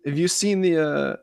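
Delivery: usual speaking voice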